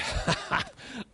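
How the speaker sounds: a short unvoiced chuckle; breathy laugh. Real, not acted. Laughs at haggler's boast.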